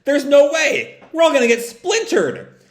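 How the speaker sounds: high, loud alarmed protest; panicked protest. Retold or imitated. Belle protesting to Tangle about the bumpy ride, high panicky protest. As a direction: sound scared of the rapids. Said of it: "Belle protests".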